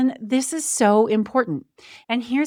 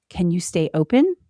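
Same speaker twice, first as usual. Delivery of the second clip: ordinary talk, nothing marked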